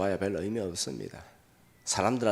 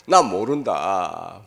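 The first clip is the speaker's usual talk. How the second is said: curt denial; 8 dB louder, much slower than usual. Retold or imitated. Peter denying Jesus.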